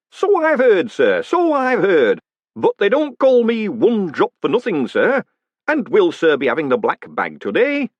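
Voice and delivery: high, loud, chummy executioner; cheery hangman. Acted. Hangman introducing himself to the condemned man, cheerfully. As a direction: greet him like an old friend at work.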